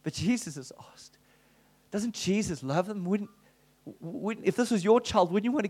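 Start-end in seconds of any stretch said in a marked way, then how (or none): 0.0-1.2 whispered to himself; a hushed whisper of resolve. Retold or imitated. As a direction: breathe it out as a secret. Repeats it as a whisper.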